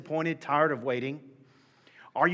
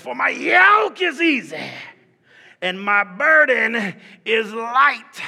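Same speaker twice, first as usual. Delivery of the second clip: much higher and louder slow shout; preaching shout; 8 semitones higher, 12 dB louder, much slower than usual. Acted. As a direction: bellow it from the pulpit. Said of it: "I'm gonna read it like I believe it".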